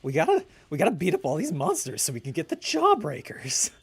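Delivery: hammed-up cartoon voice with jumping pitch; Eddie. Gamer rallies the guys as a cartoon character, loud and excitable. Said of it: "Can you do an Eddie impression?".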